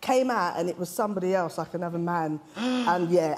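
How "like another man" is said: ordinary talk, nothing marked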